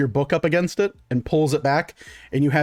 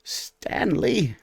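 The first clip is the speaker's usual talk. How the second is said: shopkeeper muttering; 5 semitones higher, 4 dB quieter than usual. Acted. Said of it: "looks over and goes".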